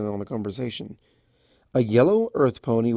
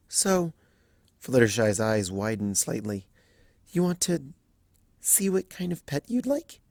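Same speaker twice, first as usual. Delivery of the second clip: timid Fluttershy; 2 semitones higher than usual. Acted. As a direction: apologise gently, barely voiced. Fluttershy soft.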